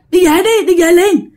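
high, urgent rush to leave; mother pleading to leave at once; urgent plea. Retold or imitated. Mom urging dad to go home.

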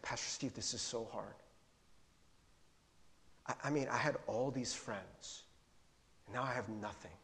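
very quiet, low, downcast voice; hushed, heavy-hearted retelling; retold tearful confession. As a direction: imitate someone broken down, barely voicing it, without sobbing. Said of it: "He starts crying".

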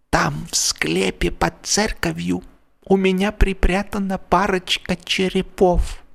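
hoarse whisper. A narrator's reading. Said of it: "хрипло прошептал".

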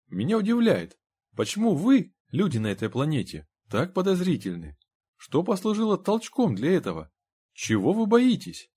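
high-pitched, insistent questioning; placating, curious character voice, pitched high; inquiring visitor Henry. A narrator's reading.

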